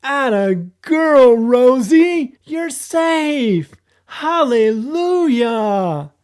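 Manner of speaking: joyful cheer. Acted. Olaf rejoices dog is safe.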